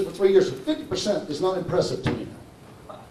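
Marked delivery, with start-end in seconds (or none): none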